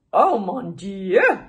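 loud, slow lovestruck swoon. A narrator's reading. Sugar glider seeing the fox, swooning in French. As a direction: melt at the sight of her. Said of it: "the sugar glider swooned".

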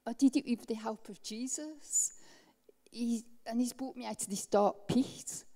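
ordinary delivery

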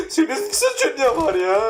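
high-pitched laughing retort. Real, not acted. Teasing a friend who claims no blame, laughing.

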